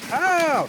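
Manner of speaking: sharp loud cry of pain; pained yelp. Real, not acted. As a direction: yelp as the bullet hits. Gets shot, yelps.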